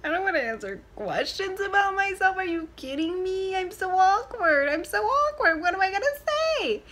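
mock awkward dread; panicked inner voice. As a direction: freak out inside in a high voice.

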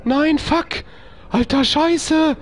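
high-pitched panicked yelping; panisches Kreischen. Real, not acted. Panics during horror game attack. (in Panik hoch aufschreien)

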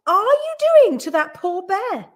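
high, loud scandalised question; crying out. A narrator's reading. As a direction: demand it in shocked indignation. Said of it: "cried Missus Brown".

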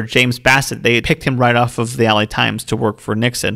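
usual speaking voice

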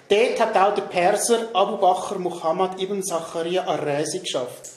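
normal talking voice

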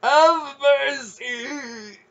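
very high, slow, wailing croak; croaking plea. A narrator's reading. Burning man begging for mercy, croaked wail. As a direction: draw the plea out in agony. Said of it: "he croaked".

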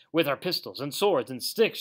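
loud, punchy list of weapons; shouted rallying cry. A narrator's reading. Rat joining in the attack plan, shouted rallying cry. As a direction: call out each weapon with force. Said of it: "shouted the Rat".

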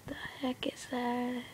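scared whisper. Real, not acted. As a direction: breathe the question out. Hushed question in the dark.

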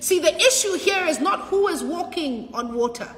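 rising, ringing sermon climax; full-force preaching. Real, not acted. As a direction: preach it out to the congregation.

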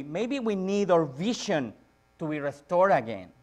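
ordinary delivery

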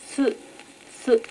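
す said alone twice, short and clear; isolated su. Shown as a demonstration. Pupil pronounces す on the teacher's request, careful. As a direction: say す by itself, carefully. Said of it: "すと発音してください".

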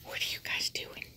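soft whispered question. Real, not acted. Owner whispering to her two dogs, whisper.

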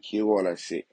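normal talking voice

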